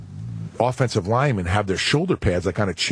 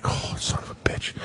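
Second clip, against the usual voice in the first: whispered curse, softer; pained whisper; 6 dB quieter than usual. Retold or imitated. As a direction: breathe the curse out under the pain. In pain on the toilet.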